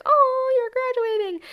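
a delighted "oh" sung up high; surprised faculty member. Retold or imitated. Faculty member realizing she graduates, high mock surprise. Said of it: "She was like".

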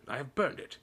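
high, firm pronouncement; short, curt. A narrator's reading. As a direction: state it curtly to close the matter. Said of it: "said Uncle Vernon shortly".

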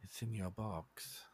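low, slow, very quiet reply; slow hushed accusation; menacing dead man. Acted. Dead Wilhelm accusing his killer, low slow hush.

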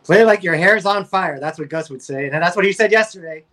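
normal talking voice

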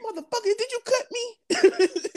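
very high, slow, laughing shock; realizing he was cut; laughing disbelief. Retold or imitated. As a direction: drag it out high through a laugh. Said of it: "I'm like".

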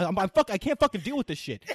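loud, high, stumbling angry outburst; retold screaming. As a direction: act out losing it in a fight. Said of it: "I was screaming at her".

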